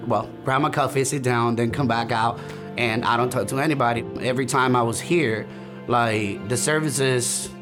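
ordinary delivery

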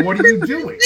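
high, loud startled cry; alarmed mother. Retold or imitated. Mother catching her son stuffing a snowball, alarmed shout. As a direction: burst in on the kid, alarmed. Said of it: "my mother comes in and goes".

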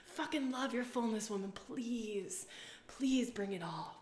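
soft, slow loving blessing; 1 tender affirmation. Retold or imitated. One woman honoring another, soft and tender. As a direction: bless her gently, trailing to a whisper.

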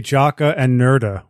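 "Jocka and Nerda" with an "a" added after Jock and Nerd; extra vowels. Shown as a demonstration. Illustrator showing his sung intro's "Jocka and Nerda", vowel endings added. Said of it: "I like to add vowels on everything".

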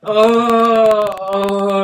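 loud, high drawn-out wail; mock crying. Imitating the sketch guy as he starts crying, loud mock wailing. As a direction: wail in hammed-up grief. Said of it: "And the guy starts crying".

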